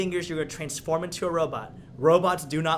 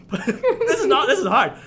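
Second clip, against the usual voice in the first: laughing through the words; giggling while talking; 10 semitones higher, 8 dB louder than usual. Real, not acted. Laughing at the mess.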